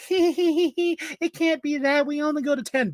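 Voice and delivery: very high falsetto titter and reply; giggle, then chirp it like a ditzy staffer; squeaky imitated woman; mock giggly lady.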